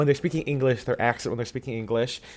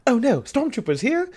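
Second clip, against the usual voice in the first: much higher fussy droid voice; C-3PO voice; 12 semitones higher than usual. Retold or imitated. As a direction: squeak it like a panicky droid. C-3PO reassembled speaking.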